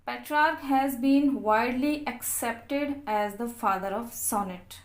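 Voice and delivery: reading notes aloud very slowly, word by word; slow dictation reading. Acted. Teacher dictating English notes to students, very slow and deliberate.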